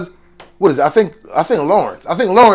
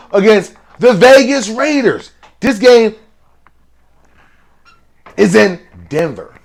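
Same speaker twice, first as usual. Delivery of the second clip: ordinary delivery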